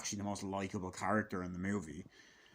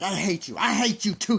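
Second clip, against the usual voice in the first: loud, high bratty outburst; mock angry shouting; 9 semitones higher, 11 dB louder than usual. Boy and adult trading hatred, mock angry shouts. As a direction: trade yells like a family fight. Acted shouted hate exchange.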